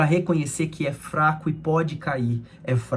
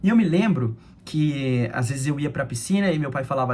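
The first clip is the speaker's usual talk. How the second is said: ordinary talk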